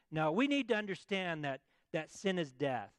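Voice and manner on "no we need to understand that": usual speaking voice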